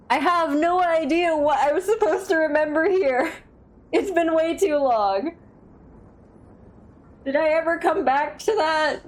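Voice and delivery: loud exasperated. Real, not acted. Can't recall, frustrated.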